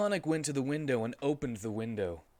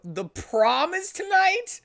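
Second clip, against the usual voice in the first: very high, loud, slow disbelief; surprised; 9 dB louder, slower than usual. Acted. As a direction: gasp out the news. Sonic learns prom is tonight.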